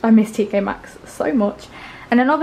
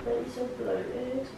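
slow, soft groan; groaning complaint; 3 semitones higher, 13 dB quieter, much slower than usual. Real, not acted. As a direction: moan it out slowly. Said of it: "slow groaning complaint".

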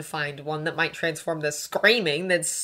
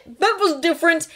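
raised, loud objection; defensive retort; 12 semitones higher, 8 dB louder than usual. A narrator's reading. Draco rebutting Hermione, defensive. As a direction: cut back in defensively.

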